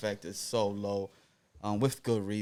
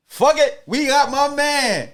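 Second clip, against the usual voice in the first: loud, high, drawn-out hype; hyped announcement; 13 semitones higher, 12 dB louder, slower than usual. Acted. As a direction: shout him out like a hype man. Hyped guest introduction.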